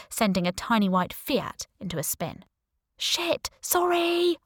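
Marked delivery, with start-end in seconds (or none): 3.0-4.4 high, startled shout of apology. Acted. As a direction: yell it out the window, flustered. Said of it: "I shouted out of the window".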